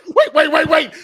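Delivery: high, loud frantic wait wait; frantic yelling. Retold or imitated. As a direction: yell it in a panic, laughing. Player yelling at ref replay.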